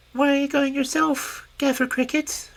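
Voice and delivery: a cheeky tiny grasshopper; lightly squeaky grasshopper princess; little grasshopper. Acted. Grasshopper voiced in story.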